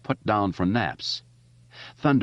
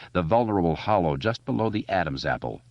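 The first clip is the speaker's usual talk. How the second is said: plain reading voice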